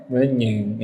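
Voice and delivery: "monyong" said without pushing the lips out; failed attempt at "monyong" with unrounded lips, vowels squashed; low "monyong" coming out like "menyeng"; lips held back. Shown as a demonstration. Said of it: "nyebut kata monyong tanpa majuin bibir".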